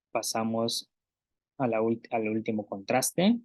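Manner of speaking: ordinary talk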